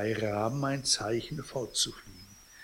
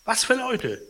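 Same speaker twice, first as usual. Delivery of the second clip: feigned innocence; 12 semitones higher, 4 dB louder than usual. Acted.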